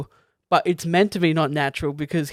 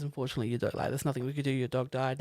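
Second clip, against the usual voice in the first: imitating a vet, much softer and subdued; hushed bad news; 3 semitones lower, 10 dB quieter, faster than usual. Film fan voicing the vet telling an owner his dog died, hushed. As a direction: break it gently, voice dropped right down.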